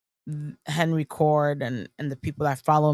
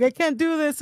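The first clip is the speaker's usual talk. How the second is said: high-pitched mock despair; self-doubting whine; 11 semitones higher than usual. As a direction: imitate yourself wailing to a supportive friend. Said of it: "I'm like".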